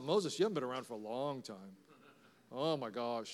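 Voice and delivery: low, hushed starstruck murmur; awed aside. Acted. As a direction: drop right down, as if awed by who just appeared. Awed aside on meeting Moses.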